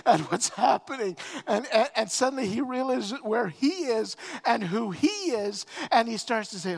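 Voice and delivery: usual speaking voice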